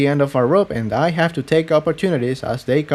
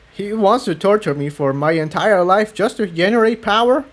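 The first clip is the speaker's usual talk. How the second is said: higher, shaken disbelief; a young stallion barely holding himself together; shaking voice; 8 semitones higher than usual. A narrator's reading. Jigsaw learns his fate from Cerulean, voice shaking. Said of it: "his voice shaking".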